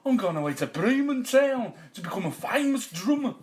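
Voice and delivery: high, loud donkey voice; eager donkey pitching a plan; talking donkey. A narrator's reading. Donkey invites dog to run away, high and loud. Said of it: "the donkey whispered to him".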